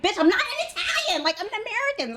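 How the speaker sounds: hammed-up squeal of protest; mock indignant. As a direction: retort way up high, replaying the quarrel. Retold quarrel, acted comeback.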